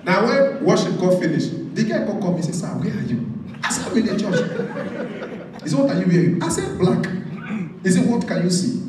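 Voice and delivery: high, loud, animated storytelling; breathless comic retelling; excited testimony. Real, not acted. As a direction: tell it like a wild church testimony. Comedic testimony, raised excited voice.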